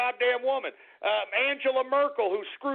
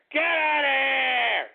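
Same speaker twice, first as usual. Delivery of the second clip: scoffing shout; 4 semitones higher, 4 dB louder, much slower than usual. Real, not acted. Dismissing Le Pen claim.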